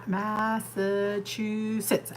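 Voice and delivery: "Massachusetts" drawn out syllable by syllable, very slow; said slowly. Shown as a demonstration. Showing chat she can only say it slowly. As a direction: stretch every syllable of "Massachusetts" carefully. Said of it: "I can say it slow".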